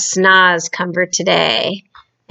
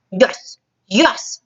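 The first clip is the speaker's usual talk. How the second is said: giant voice booming yes; 3 semitones higher than usual. Acted. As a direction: boom it like a brute. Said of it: "boomed the Blood Bottler".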